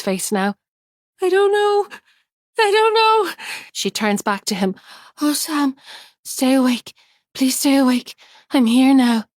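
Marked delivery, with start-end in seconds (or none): 1.2-3.3 very high, louder tearful wail; crying. Acted. Tears are streaming down. 5.2-9.2 pleading. Acted. Pleading with dying husband.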